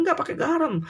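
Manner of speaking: high, heated insistence, half-mock; insistent retort. Husband insists to wife there's no salt, heated. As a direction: snap back that there's no salt.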